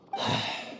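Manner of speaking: quiet heavy sigh out; soft breathy sigh. Acted. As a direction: let out a sad sigh. Mourning their lost director.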